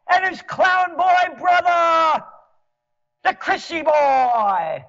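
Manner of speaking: high, loud mocking shout of a petty tyrant, building to a roar; mock Cuomo tyrant. Ranting at New York's rulers, a hammed-up tyrant governor shouting. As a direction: ham up a bossy governor barking orders.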